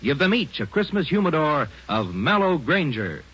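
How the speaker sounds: ordinary delivery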